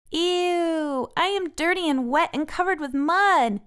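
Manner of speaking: high disgusted wail; Francine's disgusted cry. A narrator's reading. Francine covered in mud, high disgusted cry. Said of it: "Francine cries".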